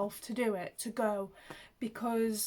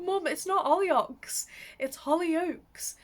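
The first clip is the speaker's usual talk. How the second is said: higher, louder, mock-exasperated correction; half-laughing, correcting her teasingly; correcting mum; 7 semitones higher, 6 dB louder than usual. Kids correcting mum's pronunciation, mock exasperated. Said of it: "we used to say to her".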